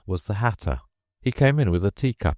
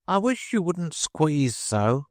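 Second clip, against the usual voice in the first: squeaky dormouse; 10 semitones higher, slower than usual. Acted. Dormouse complaining.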